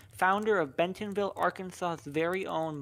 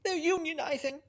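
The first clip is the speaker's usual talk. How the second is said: mock yell; 9 semitones higher than usual.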